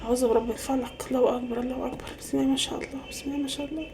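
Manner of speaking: hushed aside away from the phone; muttered protective prayer. Acted. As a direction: murmur it very softly so the caller cannot hear. Secret prayer against envy, mid-call. (تمتمة بصوت واطي جدًا خوفًا من الحسد)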